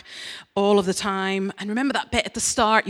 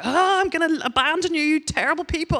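a much higher mock outraged voice; hammed-up angry God; 10 semitones higher than usual. God threatening his rebellious people, hammed-up higher voice. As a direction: play God fed up with his people, overdone.

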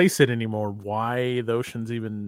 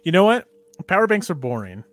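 sudden excited change; 4 semitones higher, 7 dB louder, faster than usual. Real, not acted.

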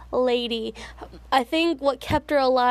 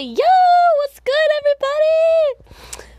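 very high, loud pet voice; imagined talking bearded dragon; 9 dB louder than usual. Pretend. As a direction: squeal a greeting as the pet dragon. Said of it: "she would say".

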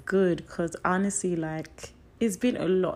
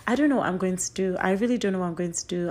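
usual speaking voice

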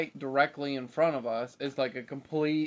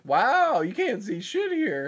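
surprised; 7 semitones higher, slower than usual. Retold or imitated. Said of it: "was like".

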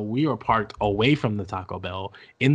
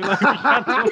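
helpless high laughter through the words; laughing hard; 11 semitones higher, 6 dB louder than usual. Real, not acted. Repeating the friend's absurd line, breaking into laughter. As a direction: repeat it while cracking up.

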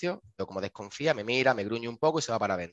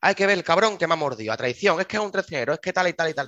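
retold indignant rant, louder and higher; flustered, angry coworker; 6 semitones higher, 5 dB louder than usual. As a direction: fume about a sneaky bite, imitating her. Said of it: "me la veo súper sofocada hablando".

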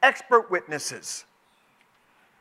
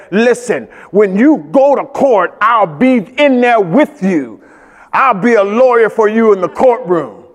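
God's promise; 9 dB louder than usual. Retold or imitated. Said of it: "the just God that said".

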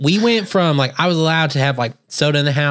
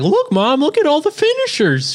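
put-on much higher, excited little kid voice; Felipe; 14 semitones higher than usual. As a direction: imitate an excited little brother showing off. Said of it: "my brother Felipe is just like".

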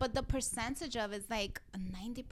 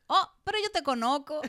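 surprised recognition; 5 semitones higher, 6 dB louder than usual. Retold or imitated. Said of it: "and I'm like".

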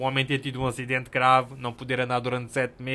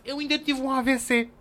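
quoting the woman; 10 semitones higher than usual. Retold or imitated. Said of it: "ainda se volta e diz".

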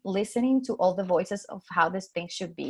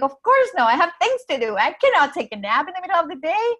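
mock-indignant, pitched higher; indignant protest; 10 semitones higher, 4 dB louder than usual. Telling her coach she cannot nap, indignant and higher. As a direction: protest the very idea of napping, voice pitched well up.

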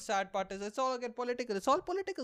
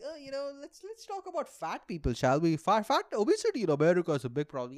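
mocking talk show host; 5 semitones higher, 5 dB louder than usual. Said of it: "they're like".

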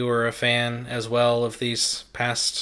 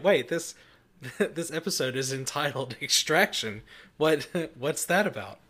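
higher, laughing through a puzzled quote; laughing mock confusion; 3 semitones higher than usual. Host voices confused listeners wondering about the episode title, laughing. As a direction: act baffled while breaking into chuckles.

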